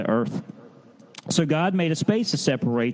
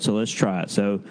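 usual speaking voice

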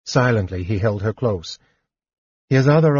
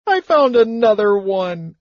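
much higher, louder blubbering; blubbering kender; 11 semitones higher, 4 dB louder than usual. A narrator's reading. Kender blubbers his confession to the companions, high and tearful. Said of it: "Tas blubbered".

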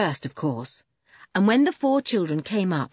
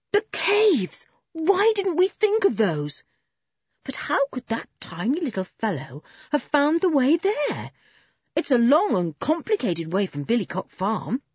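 surprised; 7 semitones higher than usual. Acted. Sudden excited realisation.

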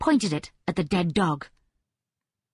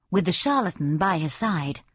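ordinary delivery, nothing marked

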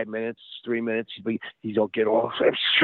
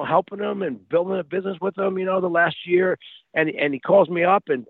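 ordinary talk

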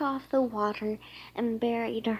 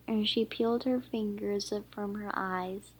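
usual speaking voice

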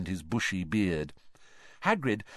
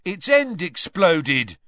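louder, higher, slow angry complaint; 8 semitones higher, 7 dB louder, slower than usual. A narrator's reading. Burned boy tells Hagrid off, angry. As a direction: complain hotly about the burn. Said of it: "said Dean angrily".

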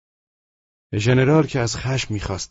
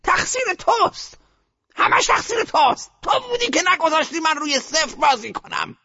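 put-on high, harsh old woman's accusation; furious old lady, high and cutting; blaming grandmother. A narrator's reading. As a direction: jab the blame at him.

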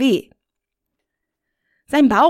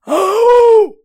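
high, loud yelp of pain; screaming in pain; 13 semitones higher, 9 dB louder than usual. Acted. Rainer whipped again, loud high yelp. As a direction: yelp as the whip hits. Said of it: "schrie er".

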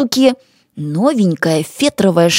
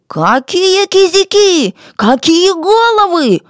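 high, drawn-out squawk of protest; indignant high character voice, words stretched; 11 semitones higher, 4 dB louder, slower than usual. A narrator's reading. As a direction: protest in outraged disbelief. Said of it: "возмутился Янгок".

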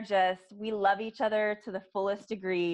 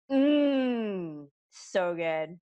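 drawn out delight; much slower than usual. Retold or imitated. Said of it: "I'm just like".

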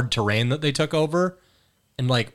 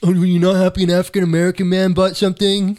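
mocking Twitter take; 6 semitones higher, 4 dB louder than usual. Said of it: "Elon Musk and Twitter just".

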